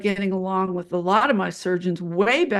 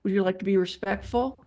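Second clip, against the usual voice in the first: put-on softer, measured talk to a toddler; 3 semitones lower, 4 dB quieter than usual. Nurse correcting a bullying surgeon, patronizing patience. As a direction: offer patient choices, as if to a small child. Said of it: "sounds like you're talking to a toddler".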